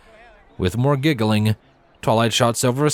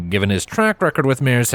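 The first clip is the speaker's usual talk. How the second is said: ordinary delivery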